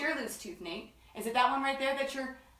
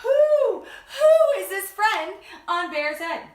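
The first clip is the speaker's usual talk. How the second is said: laughing; 7 semitones higher, 9 dB louder, slower than usual. Real, not acted. Laughing owl call.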